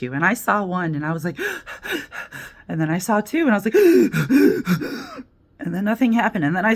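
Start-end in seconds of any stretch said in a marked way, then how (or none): 1.4-2.6 sharp unvoiced gasp of alarm; a startled airy gasp; mock gasp. Sees a cop, panics. 3.8-5.2 bigger, louder gasp of alarm; mock gasp. Sees second cop, panics.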